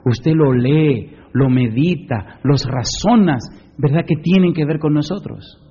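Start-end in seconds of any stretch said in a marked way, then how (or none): none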